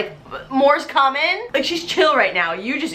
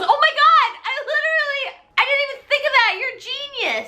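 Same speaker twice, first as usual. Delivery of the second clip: very high, excited gush; gushing Sue; 14 semitones higher, faster than usual. Retold or imitated. Sue praises Tommy's idea, very high excited. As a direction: gasp like you just had an epiphany. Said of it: "And Sue goes".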